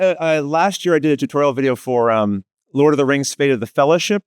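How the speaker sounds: ordinary talk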